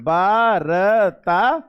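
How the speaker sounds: बरता stretched very slowly, rising at the end; syllable-by-syllable demo. Shown as a demonstration. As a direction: drag out बरता, ba-ra-ta. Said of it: "जबकि सही बर्तन कैसा है?". (बरता को बहुत धीरे, ऊपर उठाते हुए)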